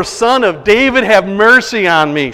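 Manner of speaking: loud plea with DAVID and mercy stretched; crying out. Retold or imitated. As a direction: call out across a crowd for mercy. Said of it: "cried out all the more".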